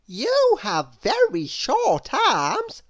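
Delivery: put-on very high, slow, delighted little girl voice; Little Miss Contrary. A narrator's reading. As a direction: gush over the room as if thrilled. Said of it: "she said to Mister Tickle".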